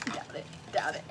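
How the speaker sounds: soft breathy self-mocking aside; mock doubt. Real, not acted. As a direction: half-whisper doubting herself.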